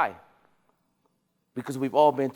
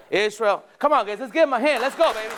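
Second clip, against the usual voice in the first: hyped cheering; 6 semitones higher than usual. Real, not acted. Rallying applause for a trainee.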